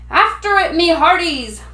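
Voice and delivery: high, loud order; pirate command. A narrator's reading. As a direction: command the crew to chase. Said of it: "Braidbeard commanded".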